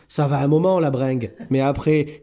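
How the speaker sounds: normal talking voice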